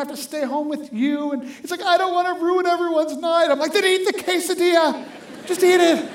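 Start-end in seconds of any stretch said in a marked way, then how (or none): 1.8-3.5 imitating a distraught child, high and loud; mock wail of an upset kid. Kid protests in retold standoff. 3.7-6.1 high, loud exasperated outburst; retold shout of a dad at his limit. Exasperated dad orders his kid.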